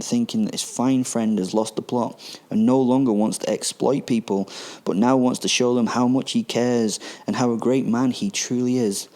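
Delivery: normal talking voice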